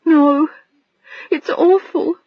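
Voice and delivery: high, tearful voice breaking; crying. Acted. Adrian collapsing into a chair before Bill, crying. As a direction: let the words crumble into tears. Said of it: "crying again".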